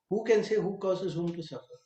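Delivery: a high, otherworldly bull speaking; lightly voiced wise bull. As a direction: answer as the sacred bull, detached. The bull answers the king.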